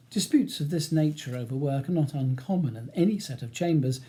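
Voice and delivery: ordinary delivery, nothing marked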